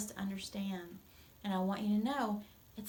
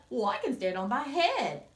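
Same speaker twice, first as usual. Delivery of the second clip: put-on silly little boy; 3 semitones higher, 8 dB louder than usual. Tucker's silly boast.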